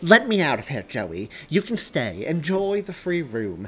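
loud, high, cutting dismissal; heated, scornful send-off; angry. Acted. Angry demand to be let out.

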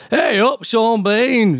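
high, mock Yorkshire greeting; broad Yorkshire accent. Doctor shows listeners the Yorkshire accent, broad Sheffield voice. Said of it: "Yorkshire accent".

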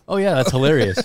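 retold reply through laughter; amused retort. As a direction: laugh while quoting your own reply. Laughing at retold grim answer.

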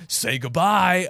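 loud, drawn-out pirate villain line; Grapple. Retold or imitated. Podcaster quoting the zombie pirate grabbing his victim, mock-menacing. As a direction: stretch it out and send it off with menace. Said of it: "and he says".

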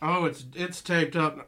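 ordinary talk

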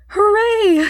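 high, loud hooray; a boy's delighted cheer; excited boy's cheer. A narrator's reading. Boy cheers as omnibus moves.

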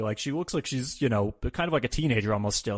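normal talking voice

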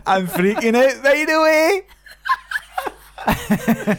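a Scottish voice drawn out high, laughing at the end; Scottish accent. Retold or imitated. Host doing a Limmy-style Scottish accent, high, slow and laughing. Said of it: "watching the Limmy show".

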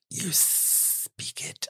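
slow, hissed snake whisper; slow Parseltongue hiss. Acted. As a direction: draw it out like a serpent. Morphin answers in Parseltongue.

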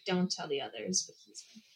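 hushed, confiding aside; mock secret. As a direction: drop to a soft, playful near-whisper.

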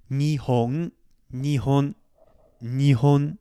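Nihon, NI low, HO up, N back down; low-high-low Nihon. Shown as a demonstration. Teacher demonstrating Nihon's low-high-low pitch. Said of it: "lower, high, low".

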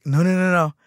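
high, insistent string of no's; hasty denial. Retold or imitated. Sibling corrects crying sister's fear, insistent. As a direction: rush to correct a misunderstanding, acted.

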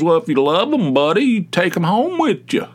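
high, hammed-up Southern accent; mock the man's thick Southern twang. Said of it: "he had some kind of southern accent".

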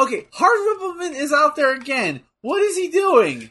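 high-pitched, exasperated questioning of the action; indignant disbelief. Real, not acted. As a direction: protest in disbelief, pitch jumping up. Reacting indignantly to the match.

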